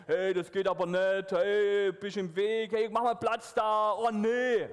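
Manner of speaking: vorgemachtes Motzen, lauter; grumbling. Shown as a demonstration. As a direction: genervt meckern wie eine ungeduldige Menge. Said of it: "dieser Motzunterton muss rauskommen". (Pastor macht den Kindern das Motzen vor, lauter und genervt)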